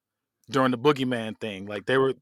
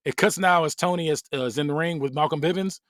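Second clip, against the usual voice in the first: usual speaking voice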